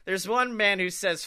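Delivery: usual speaking voice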